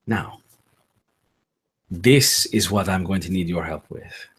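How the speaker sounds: low, hushed spirit asking to enter the mind; ghostly Piotr quietly coaxing the sleeper to let him in. Acted. GM voices Piotr's spoken request.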